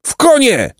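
high-pitched urgent cry to ride; shouted order. A narrator's reading. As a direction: call the order out sharply, far above normal pitch. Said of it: "wrzasnął Jacek".

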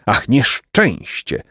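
slow, drawn-out sigh of misery; pained sigh. A narrator's reading. Tall man sighing over his misfortune, pained. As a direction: sigh it out slowly, in pain. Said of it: "westchnął długi człowiek z ogromną, widać, boleścią".

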